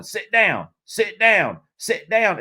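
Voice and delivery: high, loud repeated Southern commands; country official ordering. Retold or imitated. Official chanting at the crowd to sit, high Southern voice. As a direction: bark it at the crowd, country style. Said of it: "started, you know, like, going".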